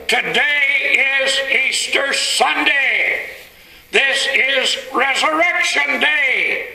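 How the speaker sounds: high, drawn-out triumphant proclamation; great announcement. Acted. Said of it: "made that great announcement".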